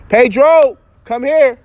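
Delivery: loud high call across the yard. Real, not acted. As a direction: shout for Pedro to come over. Calling someone from outside.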